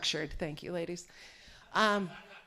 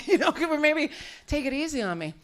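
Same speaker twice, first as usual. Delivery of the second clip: normal talking voice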